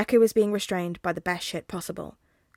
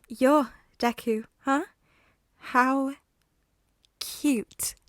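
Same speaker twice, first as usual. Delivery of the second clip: cold villain voice; 5 semitones higher, 3 dB louder, slower than usual. Acted.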